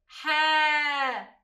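حا called out loud with a long open ا; called-out sound. Shown as a demonstration. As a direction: call out حا loudly to the class. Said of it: "كيف ننطقه؟". (حا بصوت عالٍ مع مدّ الألف)

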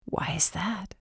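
a quiet, eerie question from the dark; soft menacing whisper. A narrator's reading. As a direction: murmur it like a threat. Hooded figure answers softly.

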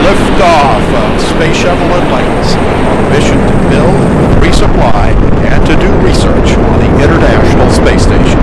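excited announcer. Real, not acted. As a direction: call the liftoff with rising excitement. Commentator calls the shuttle liftoff.

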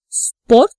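"Спорт" with a clear, full O; stressed O demo. Shown as a demonstration. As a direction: model "Спорт" for learners, stressed O kept round. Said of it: "words where O is stressed".

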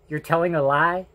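ordinary talk, nothing marked

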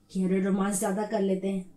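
soft, low sarcastic echo; mocking repeat. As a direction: throw his words back mockingly. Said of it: "mocking repeat".

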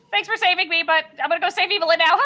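high, bright-pitched quote that speeds up; hurried character farewell. Acted. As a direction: toss off thanks and rush away laughing. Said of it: "look at Dieth and be like".

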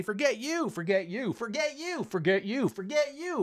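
hammed-up tit-for-tat snapping back; mock angry retort. Teacher shows answering rudeness with rudeness, mock angry. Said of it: "I could say".

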